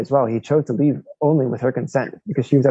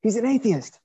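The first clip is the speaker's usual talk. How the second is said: higher pitch, urgent confidential warning; 7 semitones higher than usual. Retold or imitated. Coworker pointing out the atheist, urgent raised warning. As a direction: warn the priest about his coworker. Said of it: "He says".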